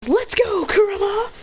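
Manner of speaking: high, eager battle call; excited high-pitched holler; rallying cry. Acted. Naruto calling Kurama into battle.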